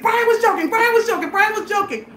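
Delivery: high, urgent repeated plea; retold insistence, each repeat hammered alike; frantic pleading. Storyteller pulls girlfriend off her boyfriend, frantic pleading.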